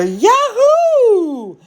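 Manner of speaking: high ringing yahoo; joyful whoop. Retold or imitated. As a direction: whoop it out like a cheer from the crowd. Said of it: "I could hear a familiar".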